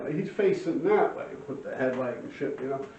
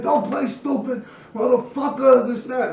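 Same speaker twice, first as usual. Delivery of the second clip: slow, louder imitated threat; mock threat; 8 semitones higher, 6 dB louder than usual. Gangster threatening the bleeding thief, mock anger. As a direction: lean on him like a thug.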